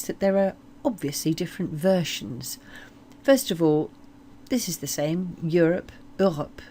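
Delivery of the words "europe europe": Europe said the French way, as Europe with a French R and vowels; French accent. Shown as a demonstration. Teacher shows Europe with a French accent. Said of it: "you do pronounce it with a French accent".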